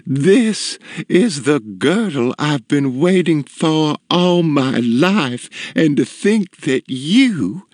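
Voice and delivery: high, slow lilting rapture; enraptured matron, sing-song; melodious. A narrator's reading. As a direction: gush tunefully, savoring the words. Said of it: "her voice a melodious tune".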